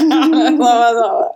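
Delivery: slow, giggly denial through a laugh; real embarrassed laughter. Real, not acted. Told her friend makes her laugh most, laughing.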